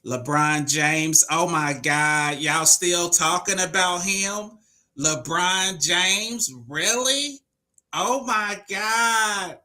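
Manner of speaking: high, slow, whining mockery; whiny hater voice. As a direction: whine it like a hater. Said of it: "That's what the haters say".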